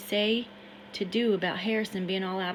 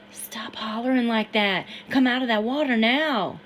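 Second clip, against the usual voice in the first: begging; 3 semitones higher, 8 dB louder than usual. Acted. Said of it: "I begged".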